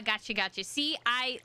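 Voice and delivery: usual speaking voice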